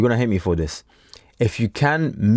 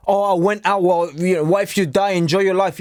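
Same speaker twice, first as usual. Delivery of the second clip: lightly voiced carefree spender, higher; mock careless spender; 5 semitones higher than usual. Mocking a viewer who wasted his salary, breezy higher voice. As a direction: play the breezy excuse-maker, half mocking.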